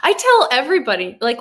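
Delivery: usual speaking voice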